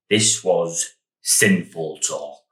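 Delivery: slow, low, mock-pious verdict; mock solemn parent. Son mocking his churchy parents' verdict, slow and low. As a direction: intone it like a disapproving vicar.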